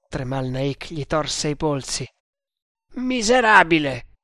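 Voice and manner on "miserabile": higher, louder angry insult; enraged yell. Acted. Tremal Naik screams into the prisoner's ears, enraged. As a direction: yell the insult right at him. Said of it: "gli urlò negli orecchi".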